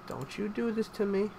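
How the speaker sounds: soft, hushed pleading; hushed plea. Real, not acted. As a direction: beg the game under your breath. Pleading with the game.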